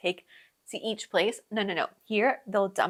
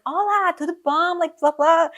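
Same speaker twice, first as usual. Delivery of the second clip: higher, louder imitation of a Brazilian Portuguese accent; native Brazilian accent; 8 semitones higher, 6 dB louder than usual. Shown as a demonstration. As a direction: put on an eager Brazilian Portuguese greeting. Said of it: "I try to sound like a native".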